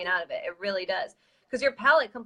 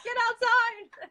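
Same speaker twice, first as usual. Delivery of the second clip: much higher, very slow laughing command; mock angry order; 13 semitones higher, much slower than usual. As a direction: stretch it out while cracking up. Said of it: "I was like".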